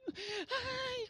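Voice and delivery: high, soft mock wail; hammed-up childlike sob; imitated child crying. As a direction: whimper like a little child. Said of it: "como llora un niño de verdad".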